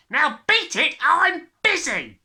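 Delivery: loud, slow, high snapping dad; angry snap. A narrator's reading. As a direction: snap at the child. Father dismisses her.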